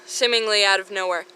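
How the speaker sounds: ordinary talk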